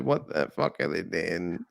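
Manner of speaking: bewildered viewer reacting to eyeballs; low slow disbelief. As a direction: drag it out in horror. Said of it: "I was like".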